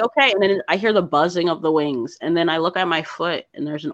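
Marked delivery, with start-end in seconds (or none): 0.0-0.3 high, loud startled okay. Retold or imitated. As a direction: jump at it. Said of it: "I'm like".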